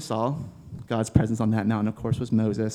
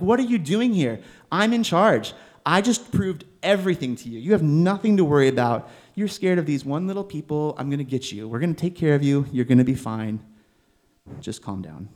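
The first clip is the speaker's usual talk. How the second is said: exasperated God; 4 semitones higher than usual. Acted. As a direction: play God as an impatient parent who then soothes. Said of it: "maybe like a Jonah kind of comment".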